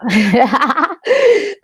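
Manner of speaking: high, loud peals of laughter; laughing. Real, not acted. Host jokes about cracking up in lockdown, laughing hard. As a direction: burst out laughing at your own remark. Laughs at losing the plot remark.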